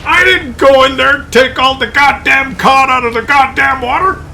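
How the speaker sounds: high, loud, slow furious outburst of a politician who finally loses it; mad politician. Retold or imitated. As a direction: shout it out, slow and furious, as if losing control. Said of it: "he just got mad and he says".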